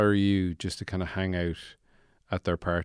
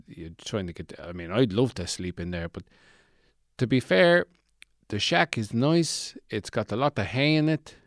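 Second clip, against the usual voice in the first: usual speaking voice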